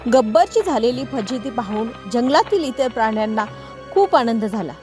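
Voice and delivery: ordinary delivery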